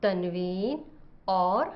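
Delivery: each sound drawn out and spaced; teacher spelling out vowel sounds slowly; slow lesson listing. Shown as a demonstration. Wife teaching husband the vowel sounds, slow and careful. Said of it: "آج کا سبق مختلف آوازوں".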